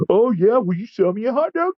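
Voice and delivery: high, sneering put-on voice; mocking Nazi streamer. Streamer baiting the vendor for a reaction, high mocking voice. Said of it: "I'm not even doing an impression".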